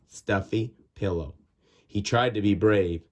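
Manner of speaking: ordinary delivery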